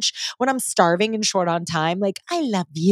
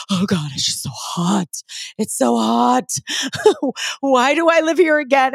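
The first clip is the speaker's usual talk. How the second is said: mock exasperation; 4 semitones higher than usual. Complaining about Arizona summer heat.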